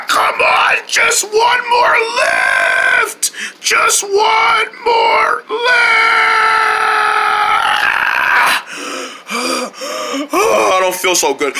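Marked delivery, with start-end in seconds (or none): 0.1-5.6 straining. Acted. Straining through a heavy lift. 5.7-10.3 long strained sigh. Acted. Exhausted after failed lift.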